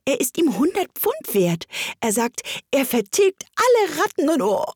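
high-pitched, gushing chatter; eager. A narrator's reading. Alice tells the mouse about a dog, eagerly gushing. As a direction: build in enthusiasm, then catch yourself. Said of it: "daher fuhr Alice eifrig fort".

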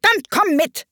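high, roared invitation; high-pitched royal shout; bellowing. A narrator's reading. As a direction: bellow it like a bossy queen. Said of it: "brüllte die Königin".

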